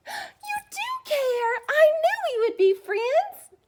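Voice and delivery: very high, slow happy dog; delighted dog voice. Acted. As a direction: coo it like a beaming puppy.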